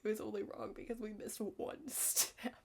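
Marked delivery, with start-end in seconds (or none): none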